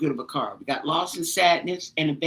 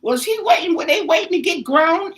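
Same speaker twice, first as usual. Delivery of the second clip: excited questions; 10 semitones higher, 6 dB louder than usual. Real, not acted.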